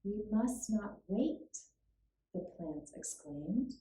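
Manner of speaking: ordinary delivery, nothing marked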